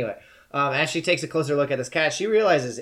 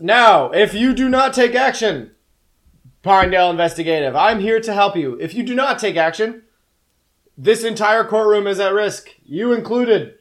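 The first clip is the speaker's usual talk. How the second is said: high, loud, commanding warning; booming cat voice; 9 semitones higher, 11 dB louder than usual. Acted. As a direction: boom an ultimatum to the courtroom as the cat. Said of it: "is this booming sort of".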